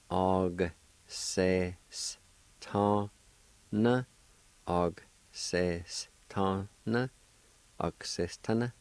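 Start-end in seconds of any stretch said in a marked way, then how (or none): 0.1-4.0 Og ses, ta, na split apart with pauses; syllable by syllable. Shown as a demonstration. Teacher breaking Og ses ta na down for learners, syllable by syllable. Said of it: "you can pronounce the G sound with the A like this". 4.7-7.1 Og ses ta na drawn out slowly and evenly; slow model. Shown as a demonstration. Teacher modelling Og ses ta na slowly for learners. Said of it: "pronounce the G sound with the A like this".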